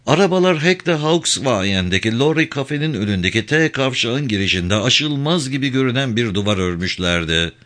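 ordinary delivery, nothing marked

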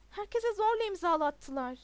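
loud, high outburst; shouting. A narrator's reading. Pupil tells his teacher of the forced signatures, shouting. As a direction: shout out the protest. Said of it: "diye bağırdı".

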